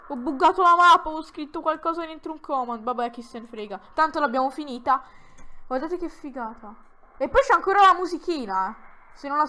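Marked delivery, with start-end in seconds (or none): none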